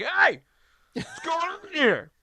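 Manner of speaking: high, indignant mock holler; other team's player. Player protesting to everyone with five seconds left, high mock holler. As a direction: imitate an opponent calling out in protest. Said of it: "the guy for the other team who was like".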